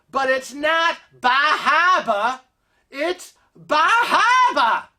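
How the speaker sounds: slow, high New England "Bah Hahbah"; New England accent. Retold or imitated. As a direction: drawl "Bah Hahbah" like a Maine local. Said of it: "this woman obviously had a New England accent".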